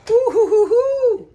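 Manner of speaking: high, loud delighted hoo hoo hoo; excited hooting. Real, not acted. Cook admiring her sauce to viewers, excited high hoots. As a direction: hoot with delight at the food. Reacting to how good it looks.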